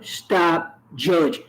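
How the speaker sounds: drawn-out, firm stop judging; slow emphatic demand. Real, not acted. Telling judgmental people what helps, slowly and firmly. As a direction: spell it out slowly so it lands. Repeating her demand to judges.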